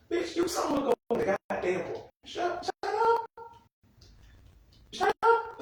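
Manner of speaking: very high, squeaky mock scolding; exasperated squeal. Viewer telling a crying character to stop, exasperated squeal.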